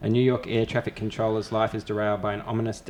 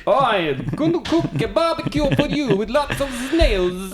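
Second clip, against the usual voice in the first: much higher, slow and louder silly chef voice; mock Manu voice; 5 dB louder, slower than usual. Mocking the TV chef Manu for a friend, high silly voice. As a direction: ham up a goofy celebrity chef. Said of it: "that's not my French accent".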